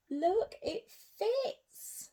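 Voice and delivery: high, slow, amazed; wonder-struck joy; delighted. Acted. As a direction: savour the happy reveal. Shoe finally fits.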